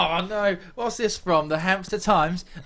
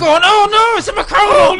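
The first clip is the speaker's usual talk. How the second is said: very high, loud shriek of disbelief; shocked shout; 13 dB louder than usual. Real, not acted. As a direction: scream it in mock horror. Shocked reaction to picture.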